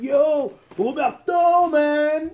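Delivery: high, stretched greeting call; old classmate calling out. Retold or imitated. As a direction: hail him across the restaurant. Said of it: "He's like".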